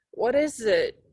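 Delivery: drowsy mumble of a half-woken mother; barely awake, words heavy with sleep; sleepy. A narrator's reading. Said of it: "Mom asks sleepily".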